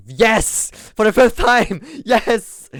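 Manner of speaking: fed up answer with a laugh breaking through; exasperated yes. Retold or imitated. Traveller answering the searching officer, exasperated and laughing. Exasperated reply to repeated question.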